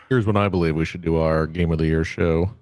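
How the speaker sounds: low, solemn and dignified. Acted. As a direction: announce it with grand dignity. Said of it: "Much more dignified".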